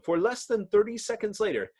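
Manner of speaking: ordinary delivery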